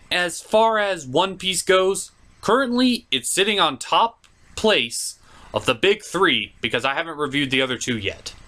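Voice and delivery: ordinary talk, nothing marked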